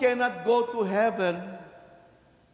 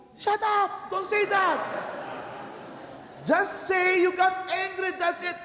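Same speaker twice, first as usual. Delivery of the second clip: mock scolding; 10 semitones higher than usual. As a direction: snap at the excuse-maker. Priest scolding the penitent.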